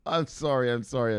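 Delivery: ordinary talk, nothing marked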